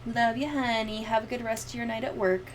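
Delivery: usual speaking voice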